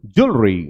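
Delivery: ज्वेलरी low, squeezed into "jool-ree"; British reduced pronunciation. Shown as a demonstration. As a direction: swallow the middle vowel of ज्वेलरी. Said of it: "अब बेटे सुनो".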